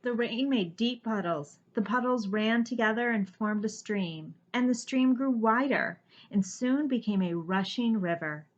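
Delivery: ordinary delivery, nothing marked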